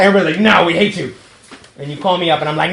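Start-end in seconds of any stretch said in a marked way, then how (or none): 0.4-1.1 loud, playfully angry retort; hammed-up group refusal; mock rejection. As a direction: snub them in mock hostility. Acts a group's hostile reply.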